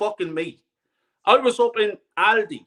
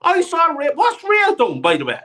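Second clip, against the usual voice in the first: furious fan ranting about the keeper; incredulous rant; 7 semitones higher than usual. Real, not acted. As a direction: rant up high in outrage. Ranting about goalkeeper Ray.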